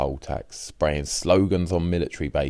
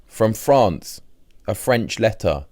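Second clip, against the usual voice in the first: a cheeky punchline, a little higher and louder; 5 semitones higher, 4 dB louder, slower than usual. Acted. Old Eddie teasing Mike to end the quarrel, cheeky. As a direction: deliver it with a sly wink in the voice. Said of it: "Eddie replied cheekily".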